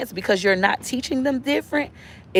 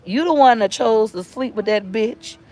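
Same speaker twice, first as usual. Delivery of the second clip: usual speaking voice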